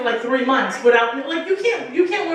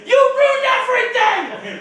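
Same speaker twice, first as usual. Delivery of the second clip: high, drawn-out mock yell; hammed-up high outraged cry at her pregnant friend; mock shout; 12 semitones higher, 3 dB louder, slower than usual. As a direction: wail it at her belly in fake outrage. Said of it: "I'm shouting at her stomach".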